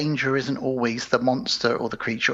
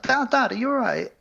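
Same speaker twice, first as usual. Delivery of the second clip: worried daughter; 10 semitones higher than usual. Retold or imitated. Daughter calls out to her father.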